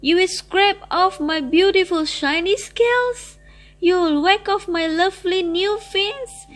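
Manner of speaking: high, loud, frantic questions; horrified pleading. A narrator's reading. Fish Lee pleads with the cook, horrified outcry. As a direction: plead in horror for your life.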